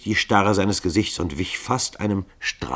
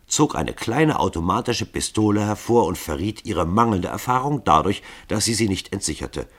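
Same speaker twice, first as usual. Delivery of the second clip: plain reading voice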